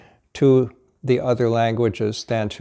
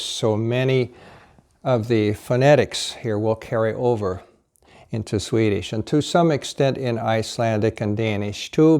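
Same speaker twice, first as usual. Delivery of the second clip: normal talking voice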